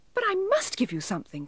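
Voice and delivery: very high elf, distressed and insistent; high-pitched, anxious insistence; upset cry. A narrator's reading. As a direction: plead it in a tiny upset voice. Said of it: "quite upset".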